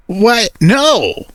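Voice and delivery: high, startled What No; shocked denial. A narrator's reading. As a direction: blurt it out flustered. Asked an embarrassing question.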